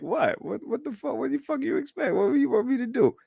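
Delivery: high, rising mock-indignant voice; voicing the man in the clip. Streamer voicing a man caught with his girl, high and indignant. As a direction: squeal it out like he's cornered. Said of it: "he's like".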